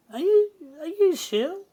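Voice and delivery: high-pitched mock old lady; older lady imitation. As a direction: put on a high, quavery old-lady greeting. Imitating older ladies greeting him.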